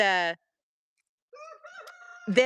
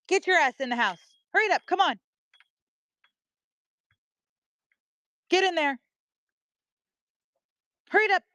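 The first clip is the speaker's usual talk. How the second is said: high, raised, commanding call; calling the dog; 9 semitones higher, much faster than usual. Real, not acted. Owner sending the runaway dog into the house, bossy call. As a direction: order a wayward dog indoors, pitched high.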